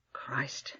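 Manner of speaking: whispered under his breath; breathed oath. Acted. Shaken Allberg swearing to himself, whispered. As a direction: let it escape as a bare whisper.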